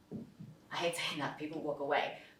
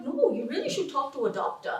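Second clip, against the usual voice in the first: higher but unforced, drama-free insistence; undramatic insistence; 6 semitones higher than usual. Retold or imitated. German boyfriend urging her to see a doctor, undramatic. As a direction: imitate someone calmly persisting. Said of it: "he wasn't doing the drama still".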